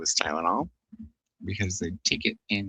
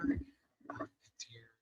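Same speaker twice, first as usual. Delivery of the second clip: ordinary talk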